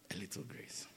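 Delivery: a bare whisper, fading to nothing. Shown as a demonstration. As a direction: breathe it almost silently. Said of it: "That is what we all need".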